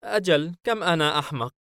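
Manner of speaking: high-pitched, sheepish voice with a nervous smile. A narrator's reading. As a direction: smile awkwardly through a high, tense voice. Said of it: "ليفياثان باسما بتوتر". (بابتسامة متوترة وصوت مرتفع الطبقة)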